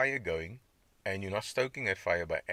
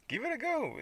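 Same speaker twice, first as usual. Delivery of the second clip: laughing. Real, not acted. Laughing at raw meat.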